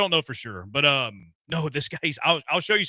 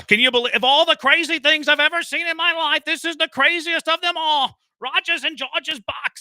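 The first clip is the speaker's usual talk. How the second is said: very high, loud mock New York announcer; exaggerated New York sideline reporter voice; terrible New York accent; 14 semitones higher, 6 dB louder than usual. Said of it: "That's a terrible accent".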